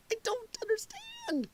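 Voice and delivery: breathy high laughter, words barely voiced; wheezing with laughter; laughing whisper. Real, not acted. Laughing at the clip so hard the words come out whispered.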